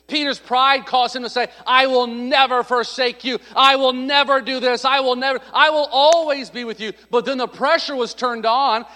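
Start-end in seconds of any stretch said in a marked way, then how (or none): 1.6-6.9 loud, high, emphatic vow; bold declaration. Acted. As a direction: proclaim it boldly, stretching the words. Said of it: "make some bold statements".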